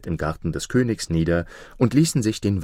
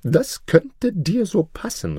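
high, slow, scornful vizier; scornful cry; 11 semitones higher, slower than usual. A narrator's reading. Vizier refuses the king the ring, scornful. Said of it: "rief er".

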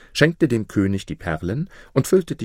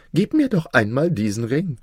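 higher-pitched, wheedling vizier; coaxing vizier; 5 semitones higher than usual. Acted. Vizier wants drunken Maruf's ring, coaxing. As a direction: ask for it in a raised character voice.

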